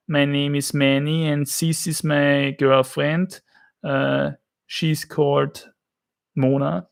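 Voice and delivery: slow, thick Austrian-accented English; slow Austrian accent demo; original Austrian accent. Shown as a demonstration. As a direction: demonstrate an Austrian speaking English, th as S. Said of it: "this is actually the original Austrian accent".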